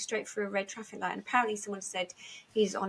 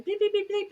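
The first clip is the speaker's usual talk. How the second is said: high, rapid bleeps; high-pitched electronic beeping, done by voice; imitated crossing beep; 11 semitones higher, 4 dB louder than usual. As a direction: mimic the pedestrian crossing signal. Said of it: "I could hear it going".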